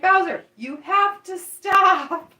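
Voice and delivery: high, loud, drawn-out telling-off; mock exasperated scolding. Student vet to a hyper dog patient, mock exasperated scolding. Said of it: "doctor was kinda mad at him".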